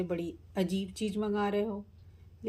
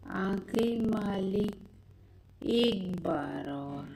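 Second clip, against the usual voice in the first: witch voice; much slower than usual. Acted. Summoned witch slowly calling out to her master. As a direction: creep in slowly like a summoned spirit.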